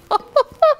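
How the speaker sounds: high, loud chortle; hearty mock laugh. A narrator's reading. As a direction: laugh dismissively as the captain. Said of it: "laughed the captain".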